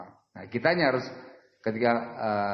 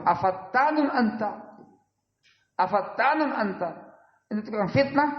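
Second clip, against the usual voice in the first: retold angry rebuke; 12 semitones higher than usual. Preacher retells the Prophet scolding a companion, high and stern. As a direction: demand it sharply, raised, mock stern. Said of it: "Marah beliau".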